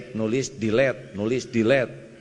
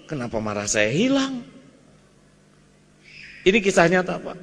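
ordinary talk, nothing marked

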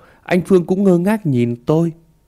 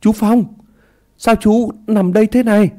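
higher, louder call across the dark; two frightened boys daring to call out to the drunk man, raised voices; 7 semitones higher, 3 dB louder than usual. A narrator's reading. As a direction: shout out boldly to reach him. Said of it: "đánh bạo hô lên".

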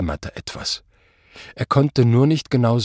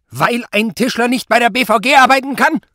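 very high, loud outburst; exploding anger; 13 semitones higher, 6 dB louder than usual. A narrator's reading. Superior jumps up at Zott, exploding in anger. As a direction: blow up at the feeble excuse. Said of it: "fuhr Prall in die Höhe".

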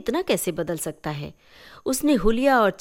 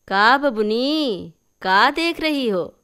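slow, loud, higher auntie voice; aunt calling; 5 semitones higher, 5 dB louder, slower than usual. Acted. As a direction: call out to the girl fondly. (धीमी, ऊँची चाची की पुकार)

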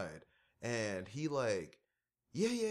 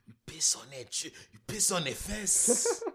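hammed-up comedian impression; 4 semitones higher than usual. Friend acting out the comedian's fence bit, hammed-up high voice. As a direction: play the comic like an outraged foreigner.

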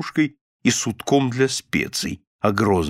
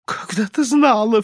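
high, tearful whimper; sobbing; 10 semitones higher than usual. A narrator's reading. Wife answering her bullying husband, mild sob. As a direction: whimper it in a raised voice. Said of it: "всхлипнула миссис Корнер".

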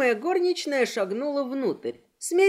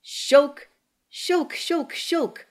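click sounds; 4 semitones higher, much slower than usual. Acted. Guns click instead of firing.

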